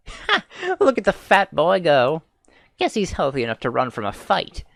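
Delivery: mocking bark of laughter. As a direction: laugh at the fleeing boy. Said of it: "barked out a single laugh".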